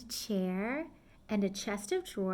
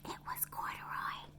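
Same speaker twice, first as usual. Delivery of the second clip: breathy secret whisper; hushed reveal; 14 dB quieter than usual. A narrator's reading. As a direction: let it out very softly as a reveal. Reveal to children.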